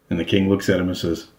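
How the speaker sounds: ordinary delivery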